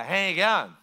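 mumbled Australian outback greeting, mouth barely open; lazy rural Australian drawl, words run together; slurred outback Australian. Shown as a demonstration. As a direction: slur it like an Aussie from the outback. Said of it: "the slurring of the speech a little bit".

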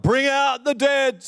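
high, slow town-crier call; high drawn-out street cry. Retold or imitated. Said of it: "ringing a bell and crying out".

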